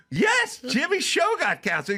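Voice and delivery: very high retold outburst; exasperated yell. Comic repeats the news to his disbelieving friend, exasperated yell. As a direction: hammer it home at the top of your pitch.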